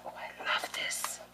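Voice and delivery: slow hushed whisper; awed whisper. Real, not acted. Crafter whispers lovingly over crepe paper. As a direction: whisper it like a secret treasure.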